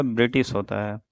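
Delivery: ordinary delivery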